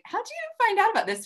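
very high astonished question; amazed. Retold or imitated. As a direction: ask in pure disbelief. Amazed DM to Zoe Saldana.

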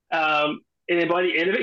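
ordinary talk, nothing marked

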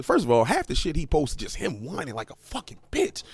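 normal talking voice

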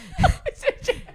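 high shriek of laughter. Real, not acted. Friend hearing he's extremely high, shrieking laugh. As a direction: crack up in disbelief.

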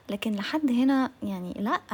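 usual speaking voice

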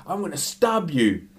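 high-pitched imitated threat; acted threat. Customer threatens the man stepping in, acted aggression.

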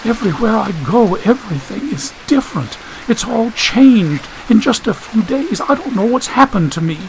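put-on high, distressed girl's voice; fighting back tears. A narrator's reading. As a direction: keep the tears out while lamenting. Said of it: "She fought to keep from crying".

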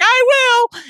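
put-on very high little boy chirp; tiny high kid voice; squeaky kid. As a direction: squeak it like a small child. Little brother answering parents.

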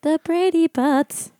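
high, slow, teasing drawl; mocking high slow repeat. Shown as a demonstration.

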